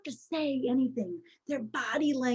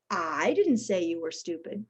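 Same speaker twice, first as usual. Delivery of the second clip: "I" stressed, the rest dropping lower; 8 semitones lower than usual. Shown as a demonstration. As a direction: lean on "I", as if someone else did. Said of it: "depending how we emphasize the word".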